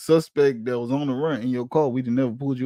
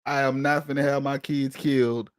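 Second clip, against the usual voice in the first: usual speaking voice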